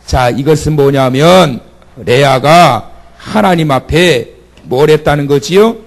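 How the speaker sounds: normal talking voice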